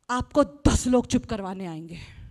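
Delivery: normal talking voice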